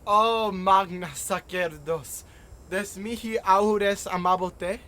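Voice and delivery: slow, loud, ceremonial Latin; formal invocation. Acted. John invoking the Sibyl, in a ceremonial call. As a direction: call out to the priestess like a ritual plea.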